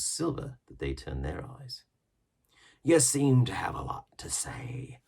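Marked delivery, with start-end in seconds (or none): 2.8-5.0 hushed, slow scorn; mocking remark. A narrator's reading. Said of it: "remarked Silver".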